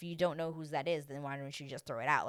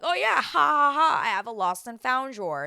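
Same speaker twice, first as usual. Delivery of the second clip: louder, higher, fake laughing; mock carefree guy; 6 semitones higher, 10 dB louder than usual. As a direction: ham up his careless joke. Mocking his joke.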